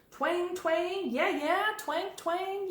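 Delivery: high, bouncing twangs; playful guitar imitation; mock guitar twang. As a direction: sound out the strums up high.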